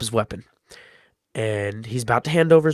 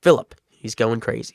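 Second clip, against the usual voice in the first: ordinary talk, nothing marked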